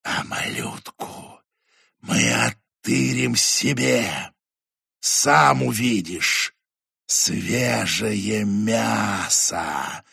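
slow hissing whisper, whistling s. Acted. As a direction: breathe it out slowly, sibilant and eerie. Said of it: "свистящий женский шёпот".

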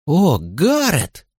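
high, very slow drawn-out squeal. Acted. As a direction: squeal his name in delight. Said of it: "заверещала Линда Ли".

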